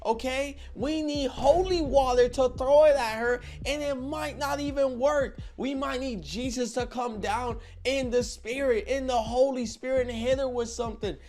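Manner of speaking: high, loud, mock-holy rant; mock-preachy rant. Warning Rick about the girl, high mock-preachy rant. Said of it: "I'm like".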